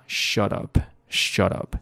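slow shut up, t tapped like a soft d; American shut up with flapped t. Shown as a demonstration. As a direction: let the t of shut flap into up. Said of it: "美式读法".

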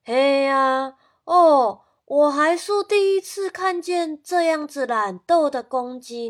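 a fussy grandpa scolding loudly; grandpa complaining. Acted. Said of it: "爷爷说". (爷爷大声抱怨)

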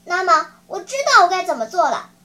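ringing, quick heroic declaration; stirring speech of self-sacrifice; bold and impassioned. Acted. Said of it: "声调豪迈激昂".

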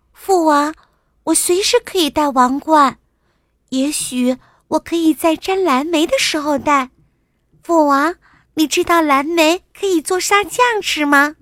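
put-on high, loud little-girl voice; cheerful princess. A narrator's reading. Princess chatting with her father about blueberries, cheerful.

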